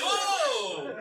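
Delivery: very high-pitched shocked exclamation; astonished whoa. Acted. Dad learns son paid friend to rake, astonished. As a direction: let out a high whoa of disbelief.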